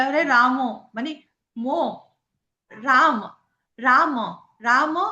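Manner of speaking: রাম said short and clipped South Indian style; South Indian pronunciation. Shown as a demonstration. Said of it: "south Indian ওরা বলে". (দক্ষিণ ভারতীয় উচ্চারণে রাম)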